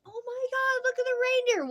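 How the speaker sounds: very high, thrilled; excited squeal. Retold or imitated. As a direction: gasp it out in delight. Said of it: "and I'm like".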